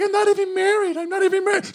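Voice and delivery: put-on very high squeaky old woman; shrill old lady. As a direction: squeal in shocked protest. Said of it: "She's like".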